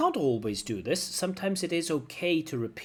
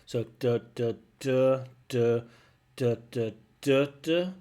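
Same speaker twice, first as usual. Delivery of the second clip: separate, evenly punched "duh" beats; mechanical, unsure rhythm; 4 dB louder, slower than usual. Shown as a demonstration. Teacher mimicking the candidate's rhythm on "duh", mechanical and unsure. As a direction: beat out each "duh" with gaps between. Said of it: "It sounds unsure".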